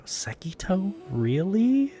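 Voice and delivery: high, mocking schoolgirl voice; high, disbelieving teen girl; giggling girl gossip. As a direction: sneer it like a catty teen. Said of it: "They go".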